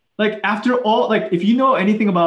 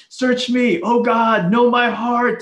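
louder, higher cry of "Search me, oh God"; raised, pleading shout to God; screaming it out; 5 semitones higher, 4 dB louder, slower than usual. Shown as a demonstration. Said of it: "He's, like, screaming this out loud".

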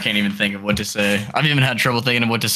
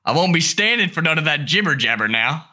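higher, indignant dad in a put-on country accent; 6 semitones higher than usual. Dad scolding his daughter's boyfriend, indignant country accent. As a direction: protest in an improvised rural accent. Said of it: "no idea what this accent is".

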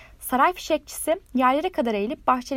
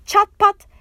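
high, loud crackling burst; sharp, high "Çat pat!" crackle; crackling cry; 8 semitones higher, 9 dB louder than usual. A narrator's reading. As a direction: pop it out like firecrackers. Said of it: "diye cevap verdi çatapatlar".

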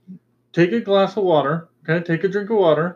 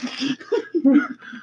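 high burst of laughter; 11 semitones higher than usual. Real, not acted. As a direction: crack up laughing.

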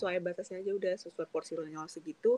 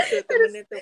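high laughing burst; laughing while talking; 10 dB louder than usual. Real, not acted.

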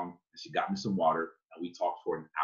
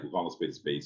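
usual speaking voice

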